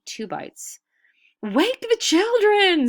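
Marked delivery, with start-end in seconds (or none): 1.4-2.9 high, loud, slow desperate scream. A narrator's reading. Adis ordering the children woken to eat sky, screaming. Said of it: "screamed Adis".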